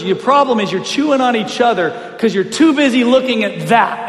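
retold coach's scolding. Coach blaming players for watching scoreboard, forceful scolding. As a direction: drive the fault home like a coach.

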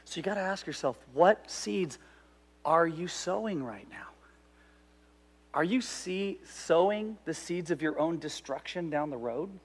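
soft, near-whispered question; hushed. Acted. Pastor asking the congregation, hushed and soft. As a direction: lean in and hush it. Sermon point made hushed.

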